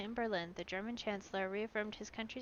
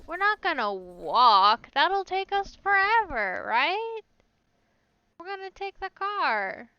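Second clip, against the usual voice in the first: higher, louder, drawn-out protest against walking; indignant excitement; 8 semitones higher, 10 dB louder, slower than usual. Real, not acted. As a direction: scoff at the silly idea of walking. Refusing to walk, exclaiming.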